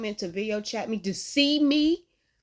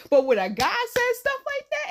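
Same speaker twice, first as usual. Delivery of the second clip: usual speaking voice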